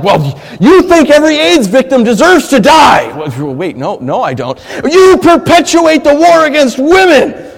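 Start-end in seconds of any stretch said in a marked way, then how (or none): 0.0-3.2 shouted accusation, loud and high; angry accuser. Retold or imitated. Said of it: "and they're like". 4.8-7.5 high, loud and slow accusation; angry accuser. Retold or imitated. An accuser confronting a believer, slow and loud.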